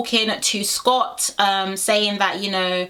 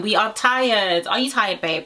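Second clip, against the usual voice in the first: usual speaking voice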